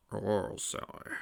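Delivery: soft, slow, whispery father; hushed, breath-led fatherly voice; old king. A narrator's reading. King Acorn greets his daughter, gentle.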